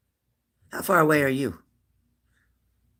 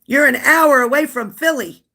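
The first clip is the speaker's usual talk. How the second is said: higher and louder, thrilled surprise; excited disbelief; 11 semitones higher, 8 dB louder than usual. Real, not acted. Streamer reacting to a viewer near Philly, excited shout. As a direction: blurt it out, delighted and amazed.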